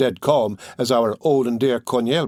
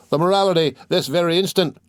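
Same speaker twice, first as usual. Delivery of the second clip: higher, pushing demand; the crowd demanding the play start; crowd shout; 6 semitones higher than usual. A narrator's reading. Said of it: "repeated the crowd".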